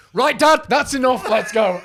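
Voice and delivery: high, very loud cut-off; retold exasperated shout. Son hauling dad away from Don, exasperated imitated shout. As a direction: shout it to end the embarrassment.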